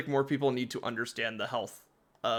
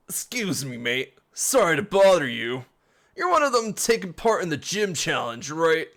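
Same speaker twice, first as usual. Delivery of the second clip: put-on a louder, cheeky British accent; 3 semitones higher, 4 dB louder than usual. Reading game dialogue aloud, a bad British accent. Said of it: "I don't know British accents".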